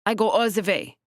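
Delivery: put-on precise, stiff thick German accent. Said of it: "Her accent was the thickest".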